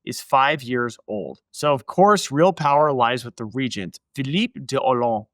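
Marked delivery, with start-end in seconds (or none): 4.1-5.3 Philippe de Hollande said with French nasal vowels, dropping the final d; a playful French accent on the name. Shown as a demonstration. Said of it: "Hope you like my French accent".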